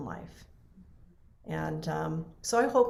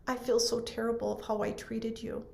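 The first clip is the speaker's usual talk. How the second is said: a softer hint of the nurse's sobbing apology; tearful apology, softer; 3 semitones higher, 9 dB quieter than usual. Nurse apologising to the widow, tearful and quiet. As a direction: let the apology sink quietly, barely holding together. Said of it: "she's bawling her head off".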